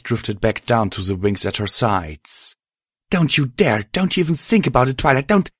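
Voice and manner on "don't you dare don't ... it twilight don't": fast, high frantic yelling; furious shout. Acted. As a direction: scream at her to stop. Spark screaming to stop her.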